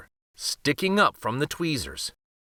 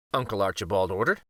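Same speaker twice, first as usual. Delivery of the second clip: usual speaking voice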